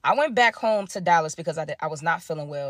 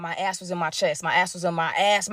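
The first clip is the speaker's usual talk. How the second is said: normal talking voice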